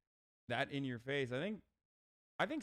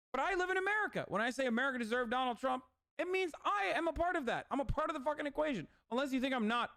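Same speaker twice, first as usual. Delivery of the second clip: heated rant; 12 semitones higher, 3 dB louder than usual. Real, not acted.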